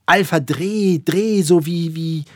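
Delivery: high, loud, halting repeats; excited, stumbling attempt to explain; breathless word-searching. A narrator's reading. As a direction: stammer while hunting for the word. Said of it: "atemlos suchte er nach dem Wort".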